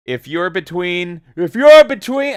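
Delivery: high, loud, slow Ric Flair strut; Ric Flair impression. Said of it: "I can't do a Ric Flair".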